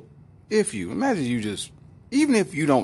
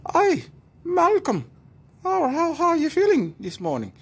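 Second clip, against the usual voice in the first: very high, loud mock woman's voice; 4 dB louder than usual. Woman greeting Malcolm on the plane, high mock voice. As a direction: squeal it like a fussy wife.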